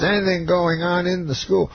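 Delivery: high, questioning impression; lightly imitated entertainer's query; Bill Cosby. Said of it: "He says".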